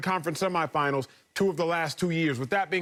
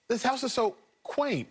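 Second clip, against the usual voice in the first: fake polite; 5 semitones higher than usual. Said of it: "you gotta say this word".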